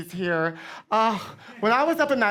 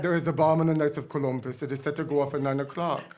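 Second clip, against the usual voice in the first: lightly voiced, lower anonymous caller; imitated threat caller; 5 semitones lower, 3 dB quieter than usual. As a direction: deliver it low, as an anonymous phone threat. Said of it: "and somebody said".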